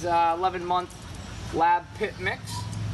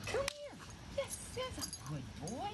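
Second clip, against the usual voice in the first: very high, soft puppy talk; squeaky praising a dog; 13 dB quieter than usual. Real, not acted. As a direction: coo at the dog in falsetto. Trainer praising dog off camera.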